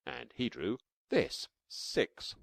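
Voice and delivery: ordinary delivery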